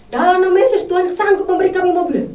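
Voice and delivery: shouted prayer. Retold or imitated. Wife declaring faith outside the car factory, shouting. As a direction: shout it as a bold claim of faith. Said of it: "Di jalan tuh teriak".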